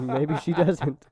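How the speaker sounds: slow and high, laughing through it. Real, not acted. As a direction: giggle the words out slowly. Teasing joke about Brie Larson.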